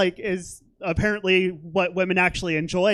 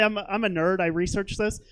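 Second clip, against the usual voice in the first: normal talking voice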